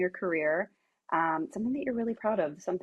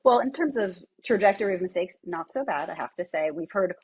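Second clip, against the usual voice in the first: usual speaking voice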